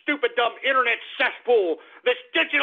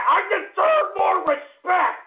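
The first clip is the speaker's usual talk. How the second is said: outraged shout; 7 semitones higher, slower than usual. Acted. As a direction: yell it, stretching every word.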